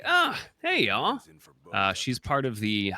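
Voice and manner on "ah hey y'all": high, loud put-on country twang for Cindy; mock country girl greeting; Cindy's accent. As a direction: chirp a hammed-up country hello. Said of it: "I don't know why she has this accent".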